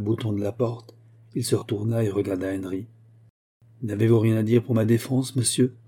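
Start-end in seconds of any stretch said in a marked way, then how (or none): none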